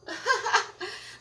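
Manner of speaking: a high burst of laughter; sheepish high giggle; embarrassed laugh. Real, not acted. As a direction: laugh at your own slip. Laughs at own slip.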